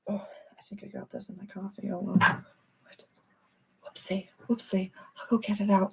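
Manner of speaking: soft whispered aside to herself. Real, not acted. Host notices something in her coffee and whispers aside. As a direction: mutter it off-mic as an aside.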